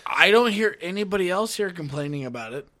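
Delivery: ordinary talk, nothing marked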